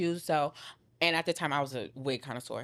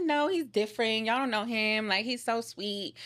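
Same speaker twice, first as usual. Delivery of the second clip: higher-pitched retold protest, drawn out at the end; defensive insistence; 6 semitones higher than usual. Woman defending him to her doubting cousins, defensive insistence. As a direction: imitate your lovestruck self arguing back.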